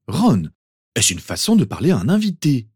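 high, loud, swooping reproach; indignant mother. A narrator's reading. Mother scolds her son over a guest, indignant. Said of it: "s'indigna sa mère".

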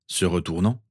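ordinary delivery

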